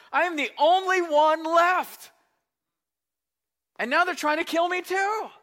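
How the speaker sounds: loud, high-pitched protest to God; complaining Elijah. Acted. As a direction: plead your case at full voice, rising in pitch. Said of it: "Elijah replied".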